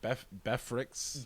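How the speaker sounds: softly imitating "Befricks" for breakfast; mispronounced breakfast. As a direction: say "Befricks", the r moved to the second syllable. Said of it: "Hetty used to say breakfast".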